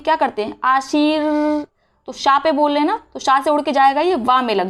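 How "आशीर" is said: आशीर stretched very slowly; drawn-out pronunciation. Shown as a demonstration. Teacher showing where the र is pronounced, very slow sounding-out. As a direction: draw out the शी and final र of आशीर. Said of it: "हम यहाँ पे उच्चारण करते हैं".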